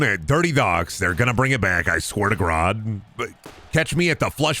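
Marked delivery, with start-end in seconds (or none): none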